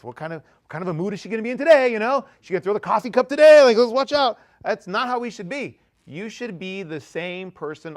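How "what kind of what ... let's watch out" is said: put-on higher, louder and quick, like anxious kids whispering about mom's mood; hammed-up dread of a moody parent; mock nervous alarm. Mocking family fearing moody mother.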